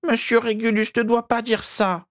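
pleading house-elf voice; high elf voice. Acted. Elf begging master, high elf voice. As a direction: beg like a loyal little elf.

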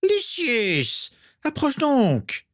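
very high, sibilant villain voice; slow, high-pitched sinister summons; Voldemort. A narrator's reading. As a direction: draw it out in a piercingly high, hissing voice. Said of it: "la voix aiguë et sifflante de Voldemort".

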